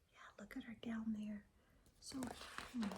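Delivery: fast whispered aside to the listener. Real, not acted.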